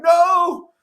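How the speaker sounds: high, loud, tearful cry of relief; crying out in pain. Retold or imitated. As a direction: sob it out half laughing, overwhelmed. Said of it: "And I'm, like, crying".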